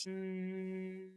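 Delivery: soft closed-mouth hum, like agreeing; gentle agreeing hum. Shown as a demonstration. Coach shows listeners a gentle hum. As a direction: hum softly as if nodding yes. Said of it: "to gently hum".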